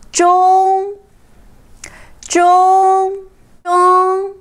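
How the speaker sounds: "中" high, flat and drawn out; first tone. Shown as a demonstration. Teacher drilling the word for middle, high level first tone. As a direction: hold each "中" on one high pitch. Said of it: "中 is first tone".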